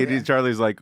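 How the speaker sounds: normal talking voice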